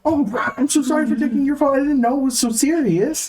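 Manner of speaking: high-pitched flustered store manager; high nervous manager voice. Acted. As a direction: play a jittery, high-voiced boss. Manager apologizing to officer.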